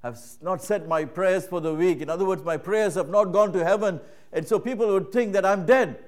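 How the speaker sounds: lightly voiced child pleading her reason; little girl's reply. Child explaining to her mother.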